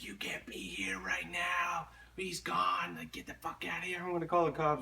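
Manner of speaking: high, hushed put-on mom voice; scolding mom. Kid's mom throwing out a stranger at night, mock scolding. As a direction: imitate an angry mother in a thin, high, softened voice. Friend's mom orders him out.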